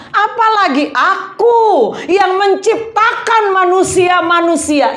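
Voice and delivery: high, forceful divine reproach; fervent sermon declamation; impassioned preaching as God. Acted. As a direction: plead insistently in God's voice, stretching key words.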